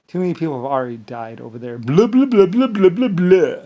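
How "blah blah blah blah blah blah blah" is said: high, loud blah-blah mockery; pitched-up nonsense chant; derisive babble. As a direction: babble it in a raised, mocking voice. Derisive babble in place of arguments.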